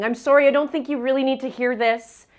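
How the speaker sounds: apologetic friend. Retold or imitated. Friend Krista apologizing on phone.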